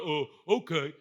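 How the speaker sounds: low, clueless assent; mock a man agreeing without thinking; dopey fellas. Men answering a stranger's call, dopey assent. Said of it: "And they're like".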